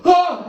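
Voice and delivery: high startled cry; startled yelp. Retold or imitated. Addict surprised in the stall, a high startled yelp. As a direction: yelp like you got caught. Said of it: "he goes".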